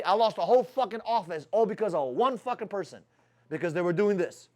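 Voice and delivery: ordinary delivery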